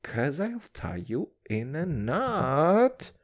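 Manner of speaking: usual speaking voice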